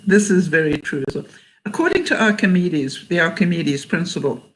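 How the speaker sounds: ordinary talk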